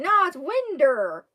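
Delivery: mock-mad correction; retold mad. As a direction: imitate an indignant schoolboy's retort. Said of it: "he actually got mad at me".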